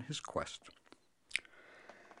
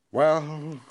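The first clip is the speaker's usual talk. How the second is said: sighing; 5 semitones higher, 7 dB louder than usual. Acted. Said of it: "sighed the king".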